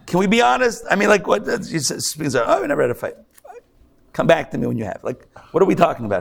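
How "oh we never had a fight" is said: mock smug couple. Mocking a couple who never fight.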